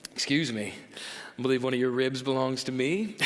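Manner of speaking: cheesy pickup-line voice. Pretend. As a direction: croon it like a cheesy flirt. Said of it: "I do this voice when I talk like this".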